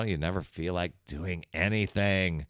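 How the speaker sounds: ordinary talk, nothing marked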